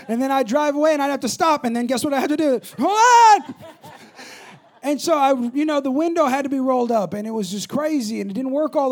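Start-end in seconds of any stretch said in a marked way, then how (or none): 2.8-3.6 loud, high drawn-out holler; comic strained shout; calling out. Retold or imitated. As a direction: yell it out to the drive-thru. Calling out at drive-thru, retold.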